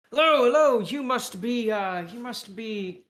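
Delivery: high, hearty British greeting; British accent. Acted. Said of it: "with a British accent".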